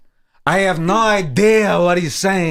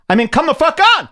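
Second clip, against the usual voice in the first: exasperated outburst; 11 semitones higher, 4 dB louder, much faster than usual. Real, not acted. Outraged at the gift clip.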